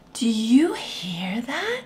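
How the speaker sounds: hushed whisper, breath only. Acted. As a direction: whisper it like a secret to a child. Hushed question to child.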